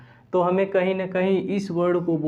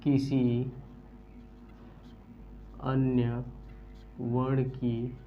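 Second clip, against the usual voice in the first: softer and lower, one word at a time with long gaps; slow dictation; 4 semitones lower, 10 dB quieter, much slower than usual. Shown as a demonstration. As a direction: read it out slowly for the class to copy down. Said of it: "परिभाषा भी लिख सकते हैं".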